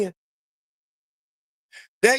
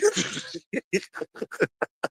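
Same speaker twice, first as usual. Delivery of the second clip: high, quieter laugh; real amused laugh; 7 dB quieter than usual. Real, not acted. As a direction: laugh high at your own remark. Laughs about fans missing list.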